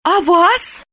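high woman's character voice; questioning Lipochka. A narrator's reading. Woman asks Roman if he was seen, high questioning voice. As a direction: ask it high and pointedly.

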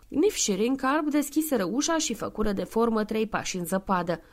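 ordinary delivery

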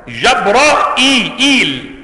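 جبرائيل stretched slowly, fatha on jim and ra, hamza with kasra then ya; جبرائيل recited slowly, hamza clearly voiced with kasra; Hamza's reading. Shown as a demonstration. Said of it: "بفتح الجيم والراء وإثبات الهمزة مكسورة".

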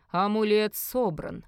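slow, ringing proclamation; loud announcement. Acted. Merlin proclaims the amulet complete, slow announcement. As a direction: declare it with ceremonial weight. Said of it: "громко объявил Мерлин".

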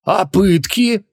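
high, drawn-out exclamation; slow, high-pitched incredulous question; exclaiming. Acted. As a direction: cry it out, stretching the words. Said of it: "одновременно воскликнули Креол и Хубаксис".

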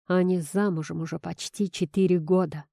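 plain reading voice

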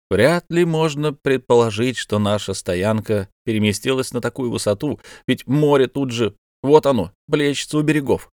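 usual speaking voice

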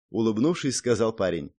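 usual speaking voice

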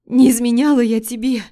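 wife denying to husband; desperate cry. Acted. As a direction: plead with all force. Wife's desperate denial.

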